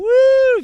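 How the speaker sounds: high, loud "woo"; party whoop. Retold or imitated. Retelling a night drinking with homeless guys, party whoop. Retold drunken celebrating with rum.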